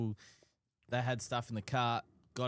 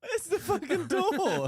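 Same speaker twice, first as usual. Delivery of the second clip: laughing; 8 dB louder than usual. Real, not acted. Cracking up mid-argument.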